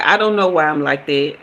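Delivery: usual speaking voice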